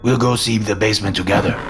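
put-on accented landlord talking through food; Dragon, mouth full. A narrator's reading. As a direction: talk round a bite of cereal, thick accent. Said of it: "speaking with his mouth full".

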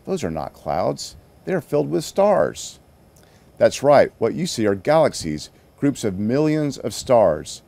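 gentle read-aloud storytelling; story narration. A narrator's reading. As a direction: tell it like a bedtime story to kids. Reading a children's story aloud.

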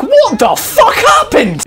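a stunned scientist finding the wreckage; drawn-out disbelieving cry; confused scientists. Retold or imitated. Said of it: "they're like".

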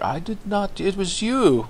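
pouty high protest; mock-innocent high whine; whiny denial. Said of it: "He's like".

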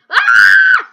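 sudden very loud shriek; scared scream. Real, not acted. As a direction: jump out of your skin. Scared by enemy.

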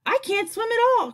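high-pitched frightened outcry; shrieky, storybook panic; panicked cry. A narrator's reading. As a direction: cry out in alarm. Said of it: "cried Ladybug".

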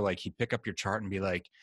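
normal talking voice

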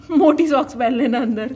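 real amused laugh through the words; amused, breaking into a laugh. Real, not acted. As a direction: laugh while admitting it. Amused confession about oversized shoes.

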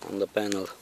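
usual speaking voice

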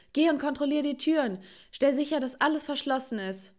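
high-pitched urgent command; panicked orders. A narrator's reading. Mother with bat telling daughter to check doors, panicked orders.

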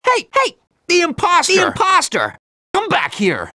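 high, urgent shouts; calling after someone. Acted. Hero spotting the fleeing imposter, urgent high shouting. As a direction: holler to stop someone running off.

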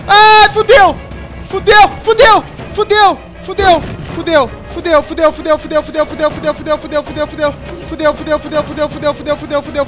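loud panicked yelling fading down; scared horror game streamer. Real, not acted. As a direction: panic loudly then lose steam. Chased by monsters in game.

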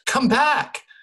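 high, loud desperate call; gasped cry. A narrator's reading. Girl calling after the vanishing voice, desperate cry. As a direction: call out urgently after someone slipping away. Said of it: "I gasped".

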